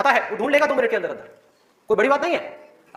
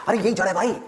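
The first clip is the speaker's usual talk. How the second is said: normal talking voice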